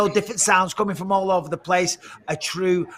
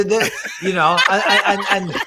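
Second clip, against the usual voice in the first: laughing; 3 semitones higher, 4 dB louder than usual. Real, not acted. Breaking up mid rant.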